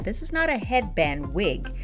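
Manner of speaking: ordinary delivery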